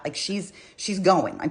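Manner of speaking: ordinary delivery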